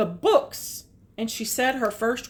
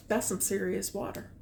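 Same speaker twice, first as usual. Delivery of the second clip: usual speaking voice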